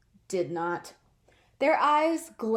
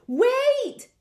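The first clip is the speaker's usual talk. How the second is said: much higher, louder call; cry out; 12 semitones higher, 6 dB louder than usual. Acted. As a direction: call out to stop him. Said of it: "Kitten cried".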